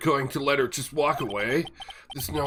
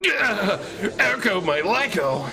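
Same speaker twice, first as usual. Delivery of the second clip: excited cheer; 7 semitones higher, slower than usual. Acted. Cheering a silly comeback.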